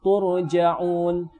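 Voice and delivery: turja'un with the silent ra heavy and full-mouthed; thick ra. Shown as a demonstration. Teacher demonstrating turja'un to the class: heavy ra. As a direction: recite turja'un twice, thickening the ra. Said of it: "wajib dibaca dengan tebal".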